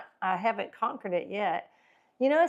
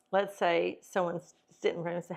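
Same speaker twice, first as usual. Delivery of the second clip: usual speaking voice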